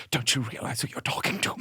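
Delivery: haughty, fast, quiet put-down; posh offended voice. Retold or imitated. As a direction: sniff down at the boy. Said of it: "He's like".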